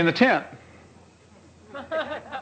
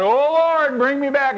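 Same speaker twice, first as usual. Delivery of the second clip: higher, pleading call to the Lord; a lightly voiced plea to God; crying out to God; 8 semitones higher than usual. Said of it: "And then I cry out".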